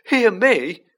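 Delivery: loud, high-pitched panicky squeal; frightened falsetto-like cry; unnaturally high voice. A narrator's reading. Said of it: "in an unnaturally high voice".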